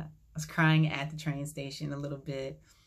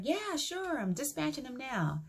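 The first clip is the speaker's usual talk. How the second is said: high-pitched, breezy dispatcher on the phone; cab dispatcher; 10 semitones higher, 4 dB quieter than usual. Retold or imitated. Cab company answering a caller's request, high put-on dispatcher voice. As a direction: put on a much higher voice for the dispatcher.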